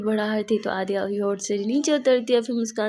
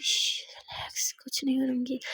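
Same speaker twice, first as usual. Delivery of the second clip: whisper; 7 dB quieter than usual. A narrator's reading. Soothing whisper to partner.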